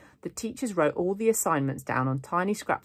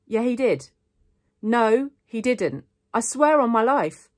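loud, higher-pitched bickering; heated insistence; 8 semitones higher, 8 dB louder, slower than usual. A narrator's reading. Two friends contradicting each other, heated. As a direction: argue it back and forth, raising the voice. Quarrel grows louder.

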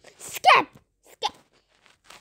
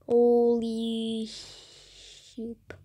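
slow amazed; 6 semitones lower, 4 dB quieter, much slower than usual. Real, not acted. Awed reaction in the game.

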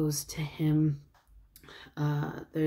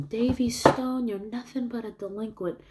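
high, raised voice imitating a yell; mild mock yell; 8 semitones higher than usual. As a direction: call it out like an accusing onlooker. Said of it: "someone yelling".

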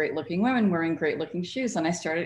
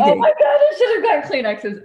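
high, loud laugh through tears; 14 semitones higher, 9 dB louder than usual. Real, not acted. Guest laughing at her own tears, needing tissues, laughing. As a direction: burst out laughing while crying.

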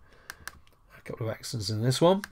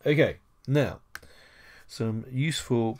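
ordinary talk, nothing marked

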